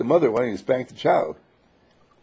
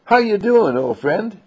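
high, loud, overly cheerful hello; cheery greeting; 11 semitones higher, 6 dB louder than usual. Acted. Greeting Doug as he walks in, acting breezy and cheerful. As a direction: greet him like nothing happened, bright and loud.

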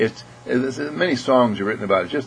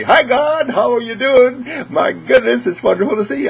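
high, louder, gleeful hello to God; delighted greeting; 12 semitones higher, 4 dB louder than usual. Acted. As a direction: greet yourself with over-the-top delight. Greets self in mirror, delighted.

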